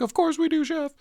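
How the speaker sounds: high, far put-on voice; mock servile chorus. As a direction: sing it out like nervous staff. Staff mock-reassuring Chef.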